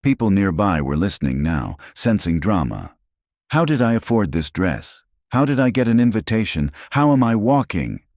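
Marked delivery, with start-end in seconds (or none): 3.5-8.0 higher-pitched, mounting questions; pointed challenge. A narrator's reading. Elena confronting Marcus at the gala, pointed challenge. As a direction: throw the questions at him, pitched up.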